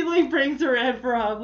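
normal talking voice

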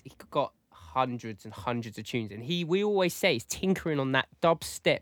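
normal talking voice

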